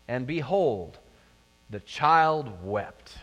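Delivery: measured Bible reading, slowing at the end; scripture reading. Acted. Pastor reading Exodus to the congregation, measured and solemn. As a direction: read the verse with weight.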